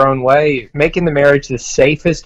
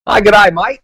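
put-on higher Australian accent greeting; aussie tradesman; 6 semitones higher than usual. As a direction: give it a broad Aussie g'day. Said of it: "How's your "G'day, mate" coming".

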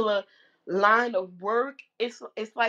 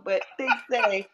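ordinary talk, nothing marked

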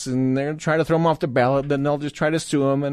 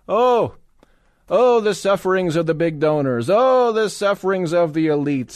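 mock lament; 5 semitones higher than usual. Host mocks big donors' sufferings, mock lament. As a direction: wail in mock sympathy for the sufferers.

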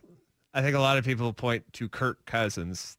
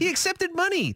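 indignant. Real, not acted. Indignant outburst.

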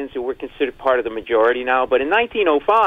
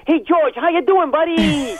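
mock greeting; 12 semitones higher than usual. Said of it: "I can't go".

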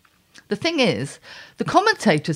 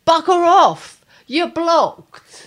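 loud, higher dismissal; bugger off; 10 semitones higher, 8 dB louder, much slower than usual. Acted. Dismissing the men who annoy her, loud. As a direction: shout them off for good.

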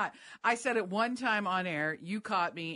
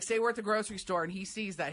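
ordinary talk

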